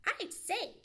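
high, loud, sharp squirrel voice; persistent squirrel. Acted. Red squirrel questioning Johnny, persistent high cry. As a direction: press the question insistently. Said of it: "persisted Chatterer the Red Squirrel".

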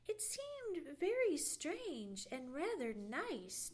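usual speaking voice

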